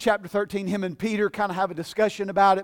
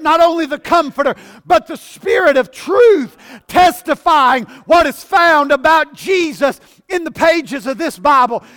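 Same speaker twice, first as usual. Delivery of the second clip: loud, rising sermon fervour; building revival preaching; full-force preaching; 6 semitones higher, 10 dB louder than usual. Acted. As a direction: preach it out to the whole church.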